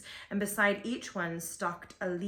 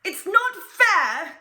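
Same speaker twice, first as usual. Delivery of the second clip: high, loud, slow tantrum cry; bratty wail; 11 semitones higher, 12 dB louder, much slower than usual. Acted. The duke cries out when every arrow fails, high, slow tantrum wail. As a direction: wail it like a spoiled duke stamping. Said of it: "he sounds terrible".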